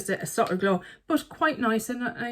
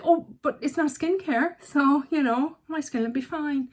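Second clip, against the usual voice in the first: high, light voice of naive protest; mock-innocent self-justifying voice; naive excuse; 7 semitones higher than usual. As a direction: play your own clueless excuse, pitched up. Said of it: "And it's like".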